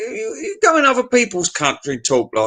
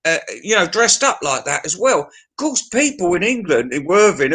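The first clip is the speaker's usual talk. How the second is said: ordinary delivery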